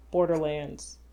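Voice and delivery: ordinary delivery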